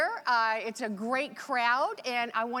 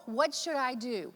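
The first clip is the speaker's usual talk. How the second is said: ordinary talk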